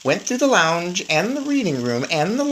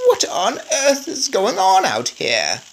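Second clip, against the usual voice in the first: put-on higher, slow old grandmother voice; startled grandmother; 10 semitones higher, slower than usual. A narrator's reading. Grandmother at her door asking about the noise, startled old voice. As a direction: demand it like a startled old lady.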